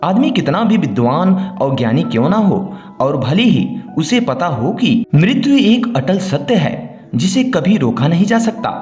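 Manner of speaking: ordinary delivery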